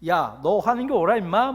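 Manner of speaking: loud, annoyed street-tough rebuke; irritated sneer. Shown as a demonstration. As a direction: snap at him like a fed-up cynic. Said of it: "짜증 나가지고 막 짱 나가지고".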